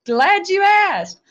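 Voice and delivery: high, drawn-out gleeful reply; playful delight. Retold or imitated. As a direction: sing it out high and slow, delighted. Jokes about wanting the money.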